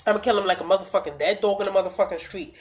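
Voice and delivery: usual speaking voice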